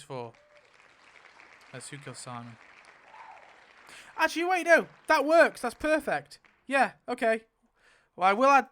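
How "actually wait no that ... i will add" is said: much higher and louder, thrilled realization; overjoyed at a lucky result; sudden excitement. Real, not acted. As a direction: jump up with delight. Realizes the pick works out.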